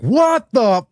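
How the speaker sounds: high, loud mock outrage; pissed off outburst. Worker fuming in his head at the boss, acted angry outburst. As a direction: blurt it in acted disbelief, cutting off the curse. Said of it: "You're pissed".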